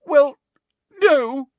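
high, loud broken wail; hammed-up tearful denial; put-on sobbing. A narrator's reading. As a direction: sob each word separately. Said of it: "sobbed Hermione".

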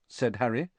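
ordinary delivery

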